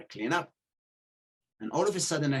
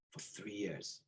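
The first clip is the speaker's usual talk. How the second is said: ordinary talk, nothing marked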